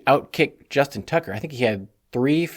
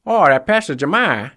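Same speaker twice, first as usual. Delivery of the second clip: higher, louder imitation of a Boston reporter; light Boston accent on a higher, louder voice; 5 semitones higher, 5 dB louder than usual. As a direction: play a thick-accented Boston reporter asking a question. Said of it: "super thick Boston accent".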